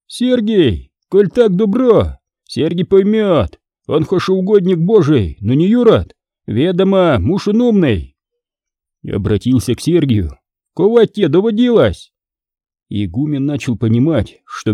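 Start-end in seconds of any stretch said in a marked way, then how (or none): 0.1-8.2 much higher brash retort; brash young Fomka. Acted. Fomka addresses Sergius. 10.7-12.1 much higher brash retort; brash young Fomka. Acted. Fomka asks Sergius.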